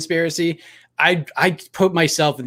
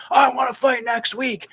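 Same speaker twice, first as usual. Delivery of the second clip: mock yell; 9 semitones higher than usual. Fighter yelling after his win, a high mock yell. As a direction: yell it like a fighter jumping out of the cage. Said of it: "he started yelling".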